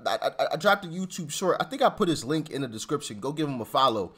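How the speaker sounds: ordinary talk, nothing marked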